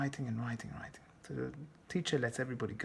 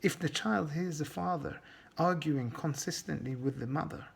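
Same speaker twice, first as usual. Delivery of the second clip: usual speaking voice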